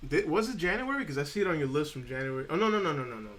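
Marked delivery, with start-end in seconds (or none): 0.1-1.0 surprised check. Real, not acted. Realizes date mix-up on list. 2.5-3.3 dismayed no no no. Real, not acted. Streamer realizing he jumped ahead a year, dismayed noes.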